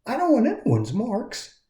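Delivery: high, soft inner resolve; quietly decide it for himself; lightly voiced hopeful puppet; wistful Punchinella. A narrator's reading. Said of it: "thought Punchinella".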